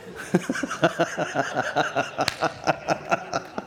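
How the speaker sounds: long rhythmic laughing fit; uncontrollable laughter. Retold or imitated. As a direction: re-enact helpless, prolonged laughing. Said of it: "I couldn't stop laughing".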